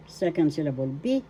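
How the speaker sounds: ordinary delivery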